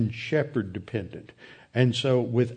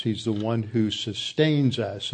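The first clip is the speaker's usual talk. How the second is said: ordinary delivery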